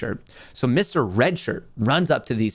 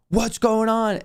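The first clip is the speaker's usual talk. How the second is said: high-pitched, agitated retelling; imitated animated girlfriend; 8 semitones higher, slower than usual. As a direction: mimic her alarm, pitched up. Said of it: "she's loud. She's animated".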